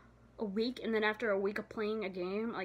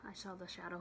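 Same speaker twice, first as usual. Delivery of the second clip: spooked whisper; 3 semitones lower, 11 dB quieter than usual. Real, not acted. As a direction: breathe it out like something's watching. Spooked by a shadow.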